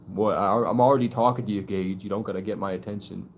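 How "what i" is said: ordinary delivery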